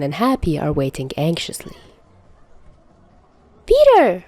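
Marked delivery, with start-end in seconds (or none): 3.6-4.2 put-on high little girl's cry; little Morgan spotting Peter, high and loud; excited child. A narrator's reading. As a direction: call out to him happily. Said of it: "Morgan exclaims".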